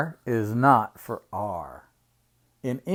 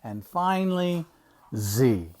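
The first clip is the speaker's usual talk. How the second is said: plain reading voice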